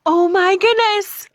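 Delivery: high, loud amazed excitement; gush, overwhelmed by the gift. Real, not acted. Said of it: "I know, I'm gushing".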